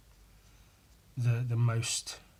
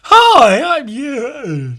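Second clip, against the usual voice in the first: much higher, loud put-on greeting voice; my usual bit voice; 11 semitones higher, 13 dB louder, much slower than usual. Vlogger trying his signature greeting despite losing his voice. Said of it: "If I try and do my".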